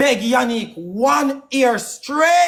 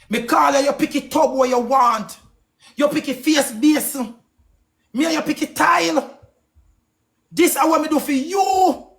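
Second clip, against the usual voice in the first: heated rant; 5 semitones higher than usual. Real, not acted. Ranting at his partner.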